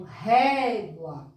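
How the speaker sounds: "Régua" with a long, open é in the first syllable; open stressed vowel. Shown as a demonstration. Teacher shows children the open sound, stressing "Ré" in "Régua". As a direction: stretch the "Ré" of "Régua", then let it fall. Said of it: "Ele tem um som aberto".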